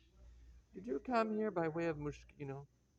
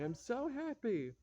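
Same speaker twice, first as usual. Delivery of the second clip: overjoyed; 9 semitones higher, slower than usual. Acted. New mother greeting guest.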